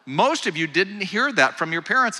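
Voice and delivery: ordinary delivery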